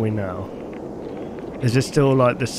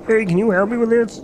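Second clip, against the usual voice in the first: imitating the mimic; 5 semitones higher, faster than usual. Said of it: "I bet it's a mimic".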